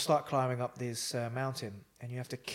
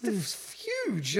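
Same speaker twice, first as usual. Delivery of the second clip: high, slow, stretched-out "huge"; staring up at the mountain, slow and high; drawn-out disbelief; 8 semitones higher, 4 dB louder, much slower than usual. Retold or imitated. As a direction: draw it out in dismay. Horrified at the climb.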